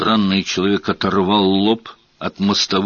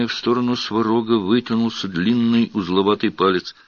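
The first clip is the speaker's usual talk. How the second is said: usual speaking voice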